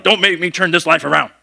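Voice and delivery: lightly voiced stern dad from the front seat; stern parent warning. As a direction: threaten like a fed-up parent. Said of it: "he's gonna turn around and be like".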